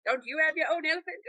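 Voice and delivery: high soft mock question, on the edge of laughing; mock Indian questioner. Mocking what Indian people ask.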